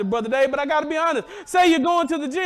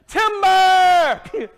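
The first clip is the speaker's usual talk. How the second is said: high, loud called-out timber; screamed timber; 4 semitones higher, 5 dB louder than usual. Retold or imitated. Old man calling out as his tree falls, loud shout. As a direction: holler it as the tree goes down. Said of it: "the old man screamed out".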